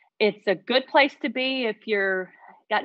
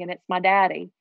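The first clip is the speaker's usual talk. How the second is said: normal talking voice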